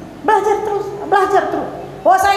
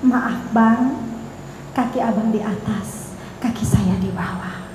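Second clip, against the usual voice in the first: soft, low, deferential apology; mild, hushed courtesy with pauses; gentle, polite; 3 semitones lower, 5 dB quieter, slower than usual. Acted. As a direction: excuse yourself very gently, voice sinking. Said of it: "kalau yang lembut".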